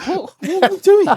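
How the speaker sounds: rising, incredulous What are we doing; mock bafflement, pitch shooting up; baffled disbelief. Retelling baffled reaction to phone answering.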